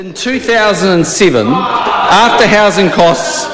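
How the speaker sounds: loud heated debating shout; shouting angrily. Real, not acted. Heated reply in parliament.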